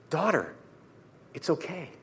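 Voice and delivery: very soft, gentle comforting; tender reassurance. Acted. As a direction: soothe a frightened daughter quietly. Jesus tenderly comforts frightened woman.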